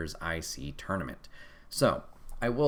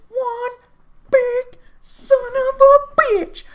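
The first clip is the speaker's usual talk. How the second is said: much higher, far louder and slow, roaring with glee; hype shout; 13 dB louder, slower than usual. Real, not acted. Hyping the monster who tsunamied Braun, slow gleeful roar. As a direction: bellow it like a wrestling hype man. Hyping Bronson Reed's destruction.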